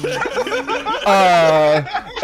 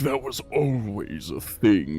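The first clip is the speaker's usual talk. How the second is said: low deity voice; 11 semitones lower, 11 dB quieter than usual. Acted. God advising a worried spellcaster, low and soft. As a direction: speak low and soft as an ancient patient god.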